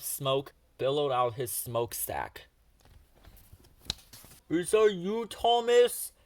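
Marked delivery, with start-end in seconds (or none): 4.5-6.1 high, loud call across the distance; calling from afar. A narrator's reading. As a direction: call out to someone far down the track. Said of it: "cried a voice in the distance".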